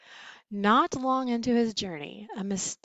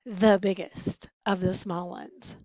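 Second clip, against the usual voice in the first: plain reading voice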